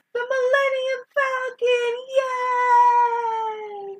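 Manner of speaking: high, drawn-out thrilled cry; fan squeal. Pretend. Host mock-cheering the Falcon's appearance, high thrilled cry. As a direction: squeal like a fan seeing the ship.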